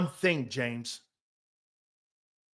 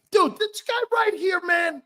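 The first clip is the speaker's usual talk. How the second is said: excited shout; 12 semitones higher than usual. Real, not acted. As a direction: shout it out, hyping the guy. Shouting praise at Keith.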